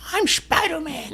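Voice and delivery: put-on high, slow little kid voice. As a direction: squeak it like a small child. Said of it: "a kid do the".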